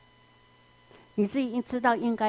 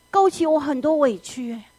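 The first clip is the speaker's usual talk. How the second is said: ordinary talk, nothing marked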